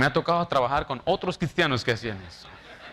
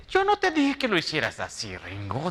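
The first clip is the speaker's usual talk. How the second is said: mock accusing boss. Pastor imitates his supervisor denying her orders, mock accusing. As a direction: play the boss lady denying it.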